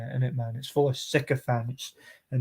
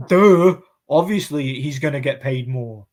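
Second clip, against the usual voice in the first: sarcastic duh; 5 dB louder than usual. Real, not acted. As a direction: throw it out like it's obvious. Sarcastic retort about pay gap.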